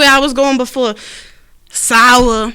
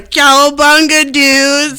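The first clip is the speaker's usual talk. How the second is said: surfer voice; 3 semitones higher, 4 dB louder, slower than usual. Retold or imitated. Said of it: "Eric just hit me with the".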